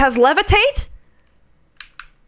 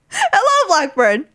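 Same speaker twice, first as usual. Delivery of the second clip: high greeting voice; 7 semitones higher, slower than usual. Pretend. Greeting in a put-on voice.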